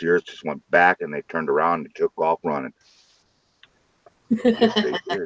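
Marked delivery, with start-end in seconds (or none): 4.3-5.3 high squeaky put-on voice; mock squeaky yes. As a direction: pipe it up high and smug. Squeaky affirmation after dog story.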